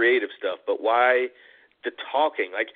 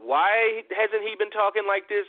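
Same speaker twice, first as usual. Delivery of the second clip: incredulous; 8 semitones higher than usual. Retold or imitated. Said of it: "I was like".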